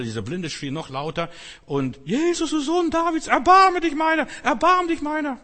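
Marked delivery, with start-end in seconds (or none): 2.1-5.4 high, loud desperate shout; blind man crying out. Retold or imitated. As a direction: cry out to Jesus with all you have. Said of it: "Aber der schrie noch lauter".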